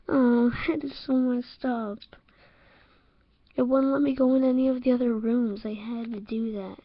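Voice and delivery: usual speaking voice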